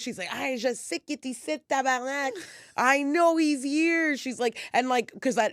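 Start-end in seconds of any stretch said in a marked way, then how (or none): 0.3-4.2 high mock Québécois rant; Québécois French-English outburst. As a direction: imitate an irate bilingual Quebec woman. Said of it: "back and forth from French to English".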